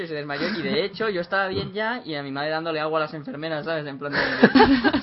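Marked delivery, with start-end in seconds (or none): none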